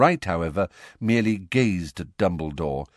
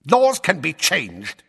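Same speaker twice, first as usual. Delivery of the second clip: louder, higher, slow savage growl; 10 semitones higher, 5 dB louder, slower than usual. A narrator's reading. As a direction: spit the words back. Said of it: "said Fudge savagely".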